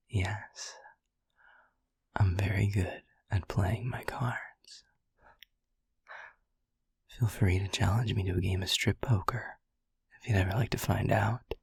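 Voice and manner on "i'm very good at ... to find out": flirty whisper. Acted. Incubus teasing the listener about movies, whispered. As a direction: breathe it close into the ear.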